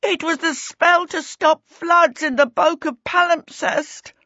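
high-pitched, frantic explaining; flustered, high-voiced confession; panicked babble. A narrator's reading. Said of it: "Peter babbled".

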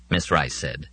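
usual speaking voice

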